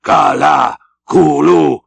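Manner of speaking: loud chanted howl. A narrator's reading. Queequeg urging his boat on, loud howl. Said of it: "howled Queequeg".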